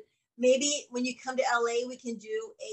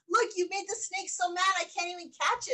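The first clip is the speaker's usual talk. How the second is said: lightly raised voice quoting husband; 7 semitones higher than usual. Retold or imitated. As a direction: quote him a bit higher. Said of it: "He goes".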